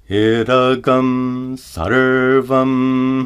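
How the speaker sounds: slow Idagam sarvam with gum at the back of the throat; Sanskrit example. Shown as a demonstration. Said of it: "For example".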